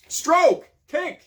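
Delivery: high, loud coach commands; yelling. Acted. Swim coach yelling at a struggling camper, loud yell. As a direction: drill the swimmer with orders. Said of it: "he yells at you".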